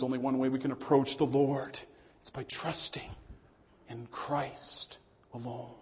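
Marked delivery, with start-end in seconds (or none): 2.2-5.7 hushed. Acted. Sermon's hushed closing point.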